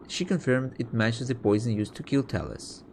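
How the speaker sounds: normal talking voice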